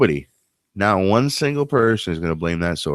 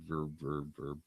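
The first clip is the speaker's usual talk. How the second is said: fading reverb echo; 2 semitones lower, 15 dB quieter than usual. Shown as a demonstration. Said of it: "add some reverb".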